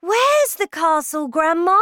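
put-on high, loud child's voice; excited cry. A narrator's reading. Boy demands the castle's location, excited loud cry. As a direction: cry out the question eagerly. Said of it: "I cried".

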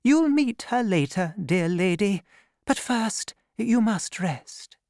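put-on high, gentle old nun voice; kindly abbess. A narrator's reading. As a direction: speak as a caring abbess. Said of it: "the abbess told her".

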